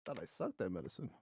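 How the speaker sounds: muttered aside. Real, not acted. Player mutters to himself.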